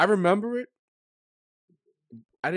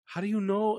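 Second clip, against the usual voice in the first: tearful wonder; 10 semitones higher, 3 dB louder than usual. Retold or imitated. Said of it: "'Cause I was like".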